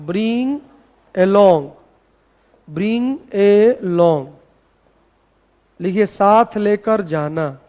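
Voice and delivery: slow, measured dictation with pauses. Shown as a demonstration. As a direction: read it out for students copying it down. (धीरे-धीरे इमला बोलने का अंदाज़)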